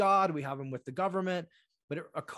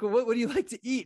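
higher, louder and fast; eager insistent questioning; 8 semitones higher, 5 dB louder, much faster than usual. Retold or imitated. Prince urges bride to share her taste, fast and loud. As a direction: press her keenly.

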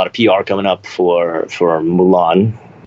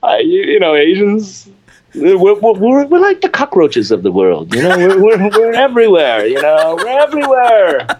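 switches on a thick Memphis Southern accent, pitched much higher; 12 semitones higher than usual. Shown as a demonstration. Chen shows off his Southern accent to the host, thick Memphis Southern twang. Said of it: "I can turn it on and off like water faucet".

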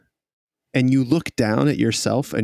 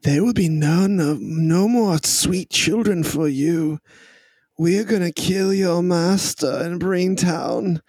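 high, mocking taunt with a laugh in it; gloating rival; 8 semitones higher than usual. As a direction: taunt him cheerfully, pitched well up. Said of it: "Jesus looks over at Pawson and says".

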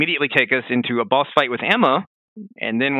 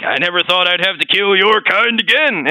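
imitating the game; 5 semitones higher, 5 dB louder than usual. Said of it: "He's like".

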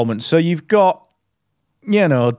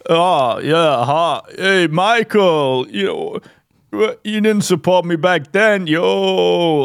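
slow, drawn-out boxer's holler; hammed-up slurred boxer, pitch swooping up; Rocky; 5 semitones higher, slower than usual. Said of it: "if Rocky was, like, in England".

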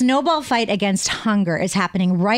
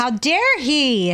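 drawn-out, higher mock indignation; mock outrage; 4 semitones higher, much slower than usual. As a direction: play scandalized, stretching each word. Mock outrage at dentist inventor.

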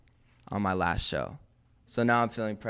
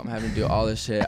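ordinary delivery

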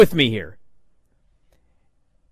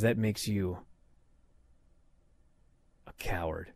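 much lower and softer, dropped delivery; low deadpan insult; 11 semitones lower, 17 dB quieter than usual. Retold or imitated. A J finally calling Miz a coward, low and soft. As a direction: let the punchline land low and quiet.

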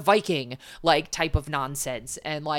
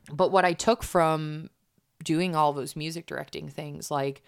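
normal talking voice

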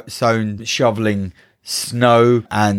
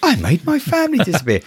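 put-on high, quick gleeful boy; smiling delight; 9 semitones higher, faster than usual. Kevin celebrating his family's disappearance, high gleeful delight. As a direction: say it through a smile, pitched up and fast. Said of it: "this time with a smile on his face".

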